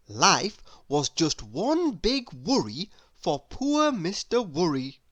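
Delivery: usual speaking voice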